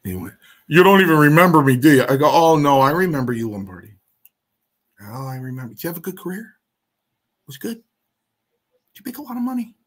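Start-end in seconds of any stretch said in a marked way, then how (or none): 0.7-2.1 loud, high, rapid confrontation; shouted accusation. Retold or imitated. Retold angry confrontation at the table. 2.3-3.9 loud retort. Retold or imitated. Retold loud retort to rival. 5.0-9.8 soft, high, climbing needling questions; mock-sweet sarcasm. Retold sarcastic needling of rival.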